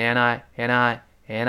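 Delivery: N I said slowly, the n of and joined to I; linked and I. Shown as a demonstration. Teacher showing how and I links. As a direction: glide the n straight into I. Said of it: "连读自然变成N I".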